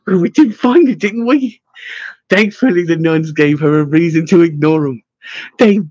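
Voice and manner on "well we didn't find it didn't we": put-on high-pitched childish sulk; petulant retort. A narrator's reading. Henwin scowling back at Buscemi, petulant. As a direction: snap back in a higher, peevish voice. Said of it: "like a petulant child".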